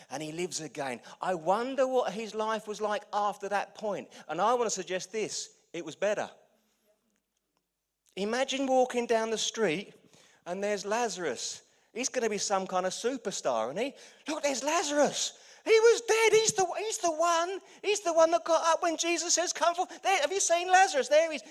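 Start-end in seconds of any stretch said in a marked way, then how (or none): 1.2-13.9 loud, higher, building preacher voice; rising sermon. Acted. Preacher painting Lazarus as a superstar, loud and climbing. 14.3-21.4 much higher, shouting townsfolk gawping at Lazarus; excited onlooker. Acted. Townsperson spotting risen Lazarus in the street, shrieking with excitement.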